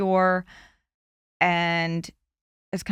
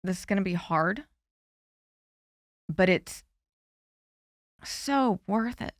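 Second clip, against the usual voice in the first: emotional. Real, not acted. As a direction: voice catching as she pushes through. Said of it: "Sorry, it gets me emotional".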